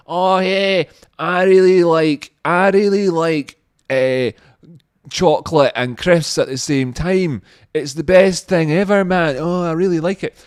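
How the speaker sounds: put-on high, slow drunken jake voice; drunk guy voice. Mimicking a drunk guy raving about his snack. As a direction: ham up a tanked Scottish jakey. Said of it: "one of them was saying to the other one".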